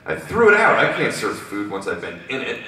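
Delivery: high, loud outburst, then settling; furious. Acted. Concessionaire asked about the spoiled dip, furious. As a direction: open with an angry burst. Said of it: "furious, climbs out of the dip".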